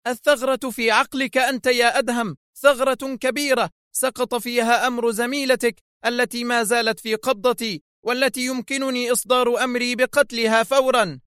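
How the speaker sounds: high-pitched angry tirade; roaring anger. A narrator's reading. Woman threatening Adham over his captive colleague, furious. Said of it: "فصرخت في غضب هادر".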